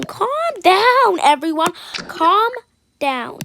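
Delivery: loud, high shout for calm. Acted. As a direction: yell over everyone to settle them. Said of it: "everyone needs to calm down".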